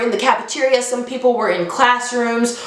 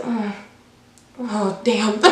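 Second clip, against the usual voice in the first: muted shock; 5 dB quieter, slower than usual. Retold or imitated. Narrator reading her friend's messages, softly stunned. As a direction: let it drop out quietly, stunned.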